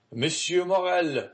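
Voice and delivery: high call from the stairs; exclaiming. A narrator's reading. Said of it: "exclaimed a voice on the stairs".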